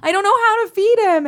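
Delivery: high mock distress; high helpless admission. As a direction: blurt it in a high flustered voice. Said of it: "And now I'm like".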